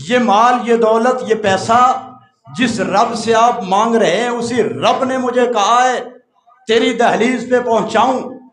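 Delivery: high, loud sermon delivery; preaching at full force. Acted. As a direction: thunder the rich man's words to the hall. Preaches rich man's words loudly. (پوری قوت سے وعظ)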